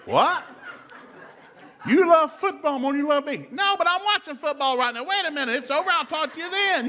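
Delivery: high, loud falsetto couple spat; mock couple squabble. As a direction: ham up a football argument. Comic husband-wife exchange, falsetto.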